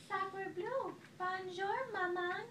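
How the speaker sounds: put-on high baby voice with French flair; very high baby duck. A narrator's reading. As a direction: chirp it like a newborn duckling.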